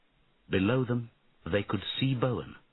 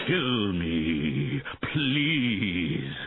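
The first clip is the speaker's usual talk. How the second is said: pleading dragon; 3 dB louder, much slower than usual. A narrator's reading. Dragon begs for death.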